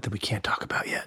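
hushed secret whisper. Real, not acted.

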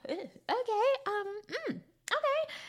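high, drawn-out doubtful okays; sceptical okay. Retold or imitated. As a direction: squeak out a disbelieving okay. Unconvinced reaction to Drew's excuse.

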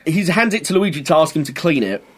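ordinary delivery

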